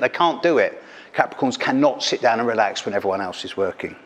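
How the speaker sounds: usual speaking voice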